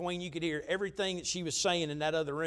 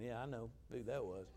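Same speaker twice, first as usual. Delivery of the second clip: much softer, lower knowing murmur; knowing aside; 7 semitones lower, 14 dB quieter than usual. Retold or imitated. Pastor answering the girl's story, hushed and knowing. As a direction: say it under your breath like a secret.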